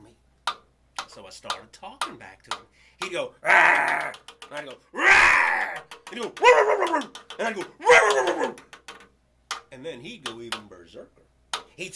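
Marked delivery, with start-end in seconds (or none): 3.4-4.1 much louder, a short roaring growl; mock dog roar; imitated dog growl. 4.9-5.8 much louder, a short roaring growl; mock dog roar; imitated dog growl. He roars back at dog. 6.3-7.2 much higher and louder roars, fading; rapid mock dog roars; imitated dog growls. Dog roaring repeatedly. 7.6-8.6 much higher and louder roars, fading; rapid mock dog roars; imitated dog growls. He roars back repeatedly.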